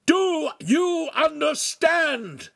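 very high, slow bellow; shouted demand. A narrator's reading. Master demands answer from choked apprentice, full shout. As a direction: shout it word by word, drawn out. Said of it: "the master repeated".

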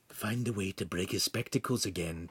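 hushed, secretive prompt; urging. A narrator's reading. Pan urges Lincoln to break the spectacles, hushed. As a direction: egg them on softly. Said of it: "Pan urged".

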